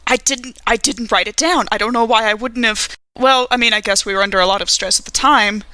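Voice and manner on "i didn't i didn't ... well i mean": normal talking voice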